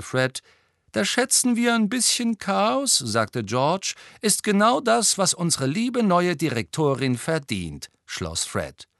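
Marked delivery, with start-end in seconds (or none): 0.9-3.0 much higher, gleeful twin voice; high-pitched prankster; cheeky twin. Acted. 4.2-7.9 much higher, gleeful twin voice; high-pitched prankster; cheeky twin. Acted.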